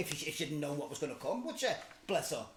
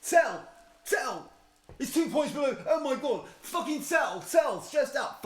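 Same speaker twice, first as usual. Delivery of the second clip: higher, much louder panicked yells of sell; hammed-up stockbroker screaming at the screen; mock trader yelling; 9 semitones higher, 7 dB louder, slower than usual.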